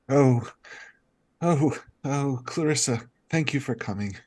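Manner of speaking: weakly voiced dazed nobleman in a poor posh English accent; a dazed cousin greets her in a shaky put-on English accent; bad accent. Dazed cousin thanks Clarissa in a bad English accent. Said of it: "Sorry, my, my accent is terrible".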